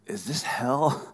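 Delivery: slow, drawn-out question; mock dismay. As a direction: ask it slowly like waking in hell. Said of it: "You know what he was probably asking?".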